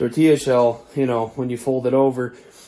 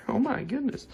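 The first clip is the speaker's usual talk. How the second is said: surprised; 12 semitones higher, 8 dB quieter than usual. Real, not acted. Surprised exclamation.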